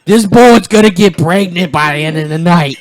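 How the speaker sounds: high, loud goofy comic voice; Tracy Morgan impression. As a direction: hype it up like a wild comedian. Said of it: "Tracy Morgan over here".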